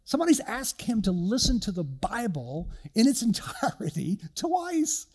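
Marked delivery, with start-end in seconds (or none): 4.3-5.1 much higher, excited burst; can't contain the excitement; thrilled. Real, not acted. Telling the congregation about his son, thrilled. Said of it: "you can tell how excited I am".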